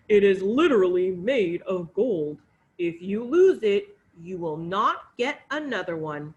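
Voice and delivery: loud, firm fatherly warning; stern king voice. Acted. King warns daughter.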